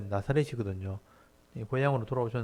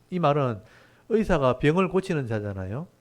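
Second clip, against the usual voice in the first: usual speaking voice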